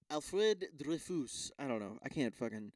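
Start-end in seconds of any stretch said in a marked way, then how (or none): 0.1-1.5 put-on botched French accent on the name; half-hearted go at a French accent; attempted French accent. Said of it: "how to do the fucking accent".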